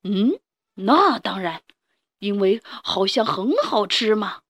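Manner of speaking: slow sly predator voice; gloating hungry dinosaur; villain dinosaur. Acted.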